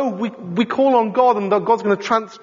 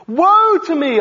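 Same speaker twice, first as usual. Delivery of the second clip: raised, higher anguished cry; cried out; 7 semitones higher, 4 dB louder than usual. Retold or imitated. Preacher voices Isaiah crying out in despair. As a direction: call out your despair, lifted and loud. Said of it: "I cried".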